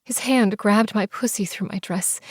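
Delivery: plain reading voice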